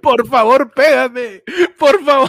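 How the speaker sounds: high, loud mock-tearful begging; wailing playful plea; mock pleading. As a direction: beg desperately, hammed-up.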